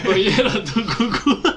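laughing. Real, not acted. Laughing joke.

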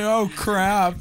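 mock dismay. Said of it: "He's like".

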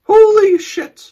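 high, loud panicked cries; scared horror game streamer. Real, not acted. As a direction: freak out at the screen. Sees six six six.

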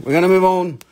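a firmer second telling; firm repeat. Acted. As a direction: push it, we're moving on. Repeating himself to move the sale on.

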